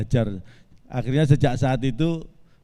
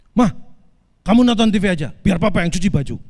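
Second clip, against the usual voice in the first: loud, higher-pitched bossy husband act; mock stern snapping; 6 semitones higher, 7 dB louder than usual. Husband shows how he orders his wife at home, mock snapping. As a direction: bark it like a husband laying down orders. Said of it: "saya bentak, saya manggilnya kan mama".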